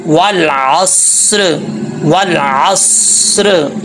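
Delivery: "वल असर" very slowly, ऐन clear and स्वाद held full and heavy; correct recitation demo. Shown as a demonstration. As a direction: recite "वल असर" drawn out, with a thick स. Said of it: "तो कैसे पढ़ेंगे". ("वल असर" बहुत धीरे, ऐन साफ़ और स्वाद मोटा करके पढ़ें)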